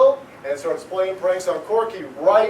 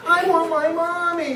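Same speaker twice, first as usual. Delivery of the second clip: put-on high, whiny little boy voice; crying child; 8 semitones higher than usual. Lost ghost boy cries for his mother, whining. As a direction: wail it like a lost little kid. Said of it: "he cried".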